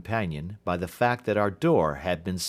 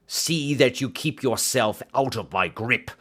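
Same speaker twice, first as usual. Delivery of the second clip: snarl; 4 semitones higher than usual. A narrator's reading. Roylott warning Holmes off, a mild snarl. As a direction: growl the warning. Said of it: "he snarled".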